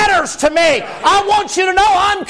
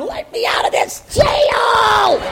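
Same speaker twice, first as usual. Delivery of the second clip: higher, slow, strained cry to be let out; the soul begging to be freed; trapped inner voice; 6 semitones higher, slower than usual. Retold or imitated. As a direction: plead like a prisoner at the bars. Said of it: "inside you is going".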